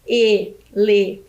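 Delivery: ELEFANTE slowly split into E, LE, FAN, TE; syllable by syllable. Shown as a demonstration. Teacher counts syllables for pupils, split syllable by syllable. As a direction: count out ELEFANTE with a gap after each syllable. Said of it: "Vamos contar o número de sílabas?".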